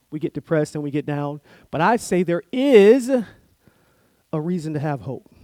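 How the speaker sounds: usual speaking voice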